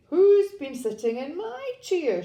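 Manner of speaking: high, loud Mummy Bear; middle-sized voice. A narrator's reading. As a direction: call it out high, as an affronted mother bear. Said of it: "she said in her middle-sized voice".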